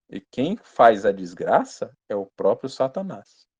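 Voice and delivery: ordinary talk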